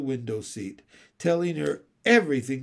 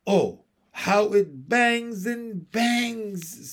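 high, drawn-out wail of complaint; pained complaint; 6 semitones higher, 3 dB louder, much slower than usual. Acted. Jane clutching her throbbing ear, pained complaint. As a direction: draw out the words as if your ear throbs.

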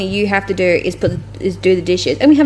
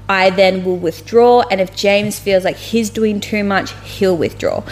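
ordinary talk

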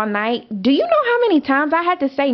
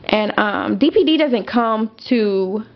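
ordinary delivery

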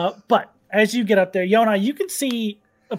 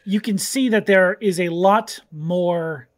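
lightly voiced scene-setting narration; game master narration; 3 semitones lower than usual. A narrator's reading. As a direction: paint the jungle calmly for players.

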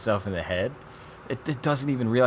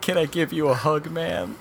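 high, louder mock-tearful plea; hammed-up emotional plea for a hug; imitated crying; 10 semitones higher, 6 dB louder than usual. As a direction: imitate a weepy man, pitched up. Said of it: "the test subject starts crying".

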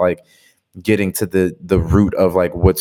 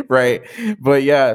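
bursts into a laugh; 6 semitones higher, slower than usual. Real, not acted. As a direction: laugh it off, then agree. Laughing at own joke.

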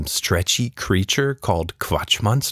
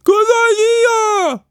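very high, loud, slow squeaky shout; cheery monster shout; 6 dB louder, slower than usual. A narrator's reading. Creature happily misunderstanding Tilda, squeaky shout. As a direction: shout it gleefully, clueless.

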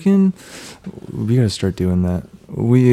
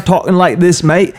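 higher, louder mock British accent; Russell Brand; 8 semitones higher, 5 dB louder than usual. Friend imitating his pal at parties, put-on British accent. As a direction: imitate a British bloke, pitched up. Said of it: "he would pretend that he's Russell Brand".

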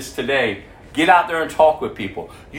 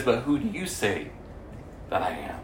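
soft pointed question; 4 semitones lower, 8 dB quieter than usual. Acted.

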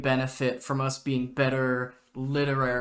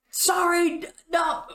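much higher, flustered cat voice; panicked high squeak; 4 dB louder than usual. Retold or imitated. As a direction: blurt it out in a panic.

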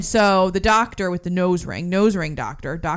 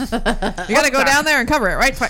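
higher, louder thirties newsman voice; 1930s cub reporter; 5 semitones higher, 4 dB louder than usual. Retold or imitated. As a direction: play a pushy old-time reporter. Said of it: "Like that".

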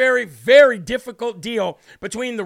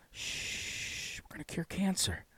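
hushed secretive whisper, much softer; whispered Biden impression; 3 semitones lower, 16 dB quieter, much slower than usual. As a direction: lean in and whisper the promise like a secret. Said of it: "Oh, Mister Biden".

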